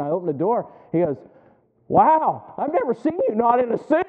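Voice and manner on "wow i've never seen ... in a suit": high-pitched amazed exclamation; Brother Corey. Retold or imitated. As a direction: imitate someone completely taken aback. Brother Corey's astonished reaction retold.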